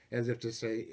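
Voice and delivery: normal talking voice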